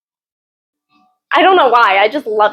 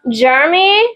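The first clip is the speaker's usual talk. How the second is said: ordinary delivery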